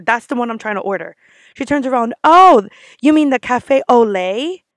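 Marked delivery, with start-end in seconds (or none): 2.2-4.6 loud, drawn-out "Oh" of mock realization; condescending. Barista correcting customer's coffee order, condescending. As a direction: correct them loudly and slowly, condescending.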